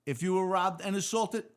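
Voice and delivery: loud, high call to a crowded room; a sergeant sorting the injured cops into lines, calling out loud; sergeant barking orders. Retold or imitated. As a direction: project it to the back of the room like a sergeant.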